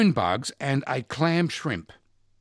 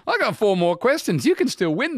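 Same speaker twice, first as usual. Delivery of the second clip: laughing; 11 semitones higher, 4 dB louder than usual. Real, not acted. Host laughing at own mistake.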